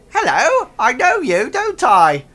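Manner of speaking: loud, high-pitched goblin greeting; space goblin. Acted. Space goblin recognising Buddy Bot, loud high goblin voice. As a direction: pitch it way up and project like an excited goblin. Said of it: "and he says".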